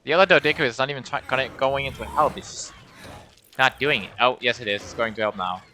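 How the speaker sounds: ordinary talk, nothing marked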